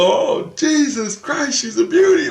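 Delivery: very high, loud, slow gushing squeal; ecstatic scream. Retold or imitated. Jesus admiring the car, screaming with delight. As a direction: squeal over the car like a fan. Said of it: "he's screaming".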